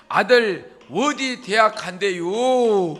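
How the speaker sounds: slow Chungcheong drawl with long-held sentence endings; Chungcheong dialect. Acted. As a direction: put on a drawn-out comic Chungcheong accent. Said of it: "충청도 버전으로". (느릿느릿 끝을 길게 끄는 충청도 사투리)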